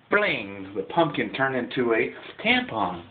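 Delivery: ordinary talk, nothing marked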